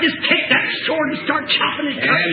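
high, fervent revival shouting; full-force preaching. Real, not acted. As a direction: exhort the congregation at full pitch.

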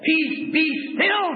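rising shouted sermon; full-force preaching. Real, not acted. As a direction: shout the miracle out to the congregation. Preaching climax on the storm.

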